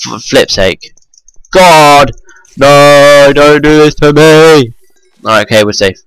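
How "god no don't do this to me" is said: loud, higher, panicked cries; panicked yelling. Real, not acted. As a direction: shout in alarm. Danger in the game.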